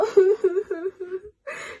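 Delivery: high loud laughing cry; laugh-cry remembering the birth; laughing sob. Real, not acted. Remembering her baby's arrival, a laughing sob. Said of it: "je commence à faire".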